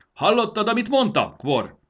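very high, loud call; shouted demand. A narrator's reading. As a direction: shout the question across the courtyard. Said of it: "kiáltotta".

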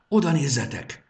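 excited call. A narrator's reading. Pointing out the cave excitedly.

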